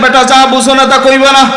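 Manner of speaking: high mock rebuke; scolding. Pir scolds the disciple again, high mock rebuke. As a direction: snap at him like a proud pir. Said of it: "বাবায় ধমক দিয়ে কয়".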